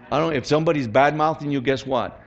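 ordinary talk

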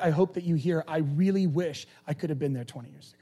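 ordinary talk, nothing marked

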